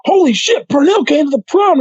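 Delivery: high-pitched mock holler of disbelief; imitated amazement. Classmates spot him at the prom, mock amazed holler. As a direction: play the stunned crowd, high and excited.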